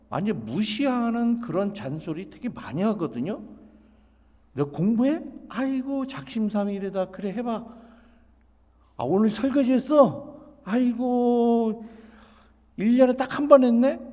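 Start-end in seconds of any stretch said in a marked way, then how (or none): 4.5-14.0 high, loud sarcastic scoffing; dismissive mockery. Mom scoffing at her child's rare effort, mockingly. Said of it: "이런 식으로 무시를 하게 되면은".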